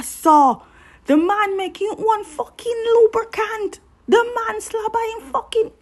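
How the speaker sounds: put-on high-pitched, worked-up Jamaican accent. Recapper cursing over a steamy drama scene, in high-pitched Jamaican accent. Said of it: "indecent language in Jamaican accent".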